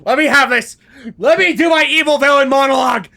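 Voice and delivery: eager shouting. Real, not acted. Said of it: "eager to play villain".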